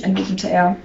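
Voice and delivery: plain reading voice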